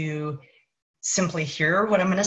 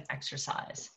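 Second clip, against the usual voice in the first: whispered, much softer; 15 dB quieter than usual. Real, not acted. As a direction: breathe the word Exercise as a whisper.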